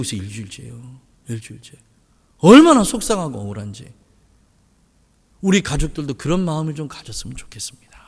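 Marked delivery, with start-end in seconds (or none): 5.4-8.0 whisper. Real, not acted. Earnest aside to the congregation.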